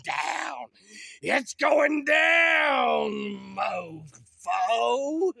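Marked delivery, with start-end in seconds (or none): 1.2-5.3 very slow, louder, higher gloat; gloating shout. Acted. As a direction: stretch it out and gloat. Gloating over the shack.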